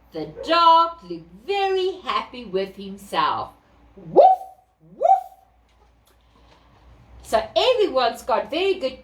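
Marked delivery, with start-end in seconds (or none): 4.0-5.2 loud yappy woof; dog woof. Acted. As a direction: bark loudly. Dog barks in story.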